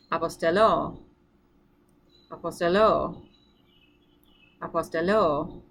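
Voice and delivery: "apostello" with stress moved to a long final LO; accent on last syllable. Shown as a demonstration. Teacher modelling the future form for students, final "o" of "apostello" stressed. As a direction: land on the last "o" of "apostello", falling. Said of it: "the accent is gonna move".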